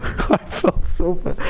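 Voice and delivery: high, laugh-broken words; embarrassed laughing. Real, not acted. Storyteller admitting they felt bad, embarrassed laughing voice. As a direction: laugh helplessly through the confession.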